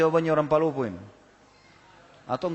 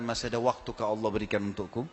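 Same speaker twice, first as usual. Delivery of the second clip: lower, softer, drained question; weak, fearful plea; 5 semitones lower, 4 dB quieter than usual. A narrator's reading. Frightened garden owner asking the stranger for time, weak and soft. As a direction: ask it weakly, head bowed. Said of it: "Tiba-tiba dia lemas".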